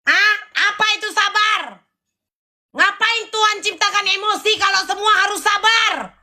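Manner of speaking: high, heated outburst; shouting at the crowd who tell you to calm down; angry rant. Real, not acted. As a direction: rail against being told to stay patient. Ranting at being told patience.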